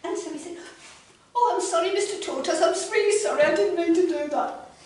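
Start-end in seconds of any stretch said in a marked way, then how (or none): 1.3-4.7 high, loud hurried apology; flustered little frog. Acted. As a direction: gush apologies like a startled frog. Freddy apologizes to tortoise.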